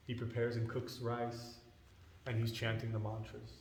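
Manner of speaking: usual speaking voice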